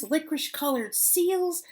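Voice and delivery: usual speaking voice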